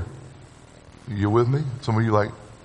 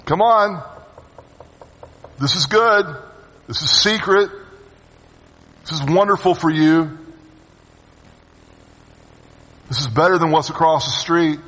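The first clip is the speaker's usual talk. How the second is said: Lady Folly's lure; 3 semitones higher than usual. Acted. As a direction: sweet-talk them into the wrong door. Lady Folly luring across the street.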